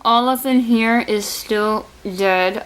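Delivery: usual speaking voice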